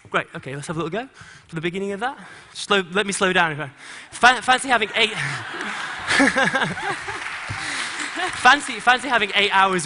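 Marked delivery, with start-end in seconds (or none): 4.1-9.9 laughing. Real, not acted. Laughing joke to dancers.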